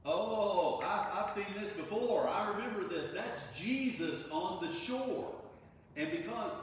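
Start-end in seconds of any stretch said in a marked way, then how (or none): 0.0-5.4 high, loud and slow, dawning realization; excited recognition. Retold or imitated. John calls out excitedly to Peter on the boat, high and loud. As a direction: let it dawn on you and burst out. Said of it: "it's John that goes".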